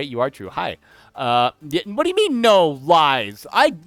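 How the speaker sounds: normal talking voice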